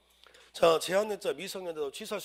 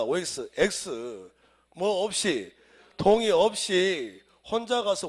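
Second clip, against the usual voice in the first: very slow and louder, each word hammered; slow emphatic; 7 dB louder, much slower than usual. Shown as a demonstration. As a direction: drill the answer into students. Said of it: "emphasizing the exam answer".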